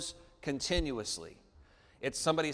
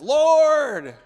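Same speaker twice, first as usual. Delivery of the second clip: calling out; 11 semitones higher, 13 dB louder than usual. Acted. Calling out to the Lord.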